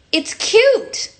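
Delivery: high, sweet pop star coo; sugary high pop star voice. Retold or imitated. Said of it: "my [name] impression".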